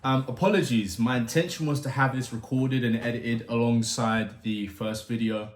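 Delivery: ordinary delivery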